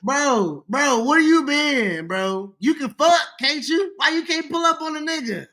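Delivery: high-pitched mock scolding; mock call-out. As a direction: call him out like a heel promo. Calling out absent co-host.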